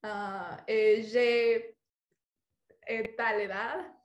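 very slow, searching for each word; halting French. Shown as a demonstration. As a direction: stall between words like a nervous student. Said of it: "algo en francés". (francés muy lento, buscando palabras)

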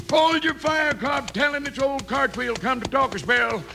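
high-pitched hail on arrival; calling out. Acted. Old Cartwheel hails the outlaw at his hideout, calling out. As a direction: call ahead so nobody shoots.

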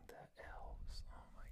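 whispered under breath in disbelief; exasperated whisper. Real, not acted. Host reacting to cohost saying elves, whispering. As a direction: breathe it out in despair.

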